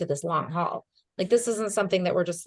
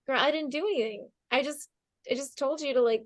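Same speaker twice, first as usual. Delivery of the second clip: modest brush-off; 6 semitones higher than usual. Retold or imitated. Modest reply, raised voice.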